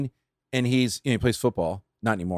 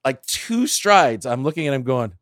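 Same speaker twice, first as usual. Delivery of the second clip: ordinary delivery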